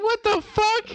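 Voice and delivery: high, squeaky what-the-fuck; mock outrage at the missing salad; shocked disbelief. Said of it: "I'm like".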